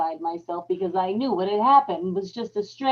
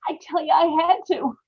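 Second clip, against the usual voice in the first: desperate; 6 semitones higher, 3 dB louder than usual. Acted. Frantic need to check the room.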